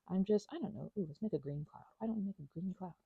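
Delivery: ordinary talk, nothing marked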